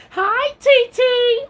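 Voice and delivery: very high, loud, slow hello; sing-song greeting. Real, not acted. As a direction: sing the name out high and long. Greeting a viewer on live.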